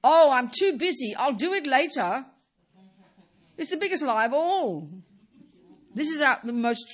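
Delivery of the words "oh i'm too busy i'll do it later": hammed-up busy excuse; mock busy excuse. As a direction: brush it off as too busy. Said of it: "we couch it as virtue".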